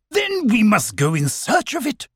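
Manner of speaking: rally the group importantly; decisive owl. Acted.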